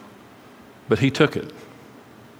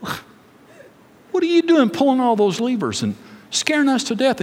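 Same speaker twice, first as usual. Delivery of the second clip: mock-indignant scolding, high and slow; Dorothy; 6 semitones higher than usual. Mad girl confronts man behind curtain, mildly mock-indignant. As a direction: put on a higher voice for a mild telling-off. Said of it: "Dorothy gets mad".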